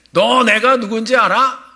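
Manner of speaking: arrogant challenge. Retold or imitated. Preacher quotes the arrogant line.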